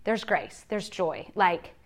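normal talking voice

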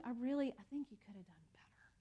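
fast, breathy whispered letdown; disappointed whisper. Pretend. God gently telling her she could have done better, whispered. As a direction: whisper the letdown, almost to yourself. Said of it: "it's more disappointed".

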